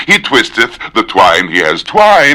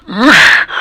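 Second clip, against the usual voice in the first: frustrated grunt; 14 semitones higher, 4 dB louder than usual. Real, not acted. Frustrated after mistake.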